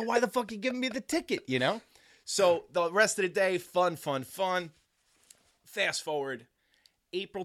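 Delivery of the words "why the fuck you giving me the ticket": high, incredulous outburst; mock indignant; indignant protest. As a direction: throw it back at the cop. Protesting to the cop.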